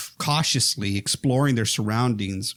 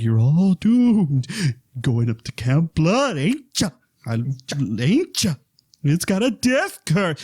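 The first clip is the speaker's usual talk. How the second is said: Crazy Ralph; 5 semitones higher, slower than usual. Retold or imitated. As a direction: warn them of doom, drawn out. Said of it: "he's crazy and he's Ralph. So he's like".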